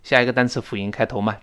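ordinary talk, nothing marked

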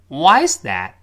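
WHY hit high, then falling through is that; stress on why. Shown as a demonstration. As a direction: punch Why and let the rest drop. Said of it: "这要重读". (Why重读，句尾降调)

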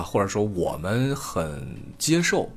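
ordinary talk

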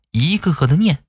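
ordinary delivery

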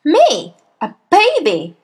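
loud, high, outraged disbelief; shouted. A narrator's reading. Headmistress insulted by the boy's suggestion, outraged shout. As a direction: shout each word as an affronted question. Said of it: "shouted the Trunchbull".

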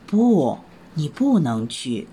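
put-on mother rabbit gently refusing her child. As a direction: say no kindly but firmly.

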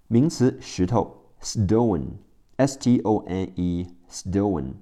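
usual speaking voice

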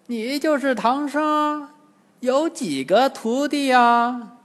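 high, slow, drawn-out demon crone; old demoness. A narrator's reading. Old demoness questioning the captive monk, high and drawling. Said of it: "金蝉老妖来到唐僧面前说".

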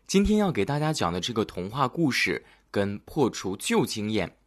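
plain reading voice

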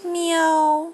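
a high little meow; high kitten mew; cat meow. A narrator's reading. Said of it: "时不时地叫一声".

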